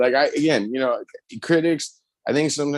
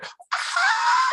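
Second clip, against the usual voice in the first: sudden shrill high scream; comic falsetto scream; character's shriek. Retold or imitated. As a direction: yelp it out like a terrified character. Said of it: "and you hear".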